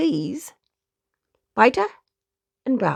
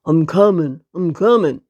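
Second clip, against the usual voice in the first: dog answering; 6 semitones lower, 4 dB louder than usual. Acted. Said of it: "barked the second dog".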